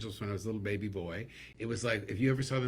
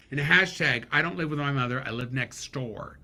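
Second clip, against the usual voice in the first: ordinary talk, nothing marked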